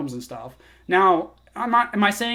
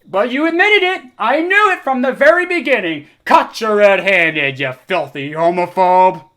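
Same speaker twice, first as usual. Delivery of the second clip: mock triumphant accuser; 2 semitones higher, 7 dB louder than usual. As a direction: gloat like you caught a criminal.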